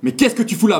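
sharp angry outburst; yelling at himself. Retold or imitated. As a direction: snap at yourself in disbelief. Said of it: "je me serais hurlé dessus".

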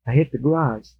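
acted sheepish wife confessing, haltingly; mildly sheepish, low mock confession. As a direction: confess in pieces, with long guilty pauses. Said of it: "kind of sheepishly".

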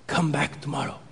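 soft, understated sensei dismissal; Mister Miyagi. Retold or imitated. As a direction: end the lesson quietly. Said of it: "last words from Mister Miyagi".

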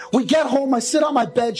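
ordinary talk, nothing marked

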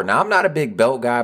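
ordinary talk, nothing marked

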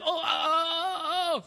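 put-on high, eager oh-oh of a kid bursting to answer; eager kid in class. Child begging the teacher to call on him, high and eager. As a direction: hand up, straining to be picked. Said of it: "I'd put my hand up".

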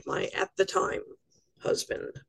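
"my at the time husband" dropped to a whisper; whispered aside. Real, not acted. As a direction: mutter it half to yourself, sardonic. Wry aside about ex-husband.

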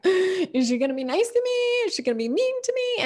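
nervous animals. Pretend. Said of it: "The other animals are like".

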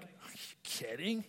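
hushed scoff then loud burst; mock teenager protesting his dad; teen scoffing. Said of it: "I'm like".

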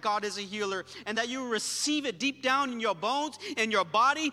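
loud, high-pitched preaching that builds phrase by phrase; impassioned preaching. Real, not acted. Preacher praying healing over the congregation, impassioned. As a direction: preach it out with full force.

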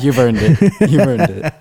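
teasing dad while cracking up; laughing. Real, not acted. Son teasing about a rest home.

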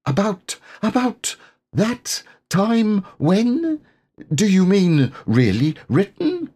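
squeaky and breathless with wonder; tiny piglet, stammering. Acted. Piglet, excited and shy.

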